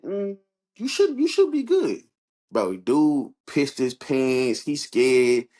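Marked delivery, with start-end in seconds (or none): 0.0-2.0 mock reassurance. Mock reassuring a scared coworker.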